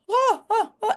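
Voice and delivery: high, sharp retold gasps of shock; startled gasps. Runner grabbed by race official, retold high startled gasps. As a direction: squeak out a few alarmed gasps.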